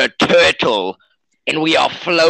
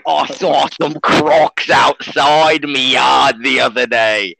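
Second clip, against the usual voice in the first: mock Australian accent. Co-host tells a croc story in a mock Australian accent. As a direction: exclaim like a cartoon Aussie. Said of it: "he's Australian, if you can't tell".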